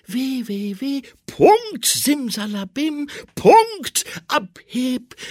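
langsam, hoch und laut beschworen; feierlicher Zauberspruch. A narrator's reading. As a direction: sprich ihn wie eine Zauberformel. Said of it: "sagten laut". (feierlich beschwörend)

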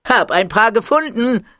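put-on high, bright boy voice; eager kid. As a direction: proudly announce the find. Muki voiced. (Muki verkündet stolz seinen Fund, hoch)

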